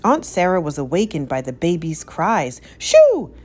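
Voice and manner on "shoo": very high loud furious yell; high loud outraged yell; angry shooing. A narrator's reading. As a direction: drive the dog off. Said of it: "she cried".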